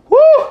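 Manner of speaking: sudden high, loud "woo"; loud, high-pitched cheer; excited whoop. Real, not acted.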